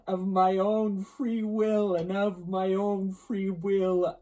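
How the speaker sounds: loud, slow, drawn-out ghostly voice; solemn ghost. Acted. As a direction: toll each phrase out heavily, like a haunting spirit. Ghost's speech in narrated story.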